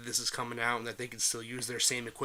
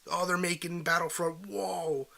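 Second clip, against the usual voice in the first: higher-pitched imitation of hyped fans; mock hype; 6 semitones higher than usual. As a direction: play an awed fan, drawing out the whoa. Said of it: "got everybody super hyped".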